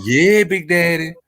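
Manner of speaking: teasing imitation of a woman's come-on; mimicking her delivery. Man quoting her back to her, teasing imitation. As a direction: play back how she said it. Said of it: "No, you said".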